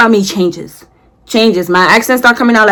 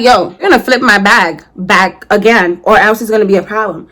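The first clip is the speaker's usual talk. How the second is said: normal talking voice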